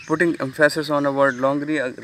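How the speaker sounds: slow, deliberate reading of the slide. Acted. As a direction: read each phrase out slowly for students. Reads English slide text. (धीमे, ठहर-ठहर कर slide पढ़ना)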